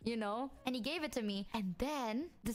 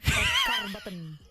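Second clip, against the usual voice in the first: louder, laughing through it; laughing outburst; 11 dB louder than usual. Real, not acted. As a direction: burst out laughing.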